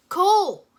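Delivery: loud, high call; shouting a name. Acted. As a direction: shout across the distance to a friend. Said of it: "Kai shouted".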